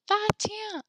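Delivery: put-on high, tiny toddler voice; little child's voice. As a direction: squeak it like a one-year-old. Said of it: "My little niece".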